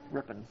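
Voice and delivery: Ripon said as RIP-un; settled pronunciation. Shown as a demonstration. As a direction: say Ripon with short I and unstressed ending. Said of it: "is it Ripon or Ripon?".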